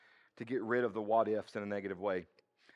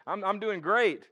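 smug bragging; 8 semitones higher, 8 dB louder, slower than usual. Retold or imitated. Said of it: "sit up there and be like".

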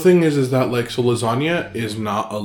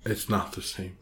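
low, soft falling mumble; quiet complaint; 4 semitones lower, 10 dB quieter than usual. Real, not acted. Co-host missing the sloppy joes, quiet complaint. Repeats own line, second whispered.

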